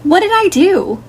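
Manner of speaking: high-pitched, pleading indignation; indignant protest. Acted. Sister demanding to know what she did, indignant. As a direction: protest your innocence, voice jumping up. Player's character protests an accusation.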